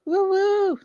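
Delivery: high playful woo-hoo; happy little sports cheer. Real, not acted. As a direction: cheer like a fan in the stands. Cheering for the win.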